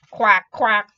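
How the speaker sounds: two loud imitated quacks; duck quack. A narrator's reading. Duck calling to the spider, loud quacks. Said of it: "called the duck".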